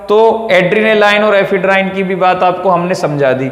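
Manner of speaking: ordinary talk, nothing marked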